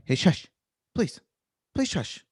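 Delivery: mildly stern shushing; a bit stern. Shown as a demonstration. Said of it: "a little bit more stern".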